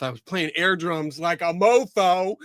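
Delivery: ordinary delivery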